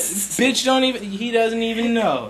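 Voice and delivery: loud, high, thrilled; excited yelling. Real, not acted. Teen loudly hyped about the flame. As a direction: hype it up to everyone.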